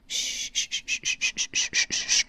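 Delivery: loud shushing. Acted. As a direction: shush chat hard. Shushing chat loudly.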